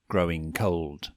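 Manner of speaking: ordinary delivery, nothing marked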